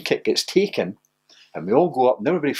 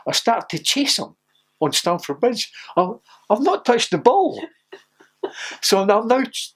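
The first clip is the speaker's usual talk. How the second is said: ordinary delivery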